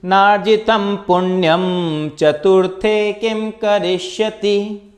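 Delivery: slow, high, loud chanted Sanskrit verse; shloka recitation. Shown as a demonstration. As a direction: recite each line slowly and loudly like a chant. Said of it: "हमारा पहला श्लोक है". (धीमा, ऊँचा, ज़ोरदार श्लोक पाठ)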